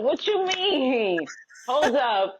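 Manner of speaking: high, drawn-out mock disbelief; retold high-pitched indignation; indignant retort. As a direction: stretch it out, taken aback, in a high voice. Retold retort to people questioning her.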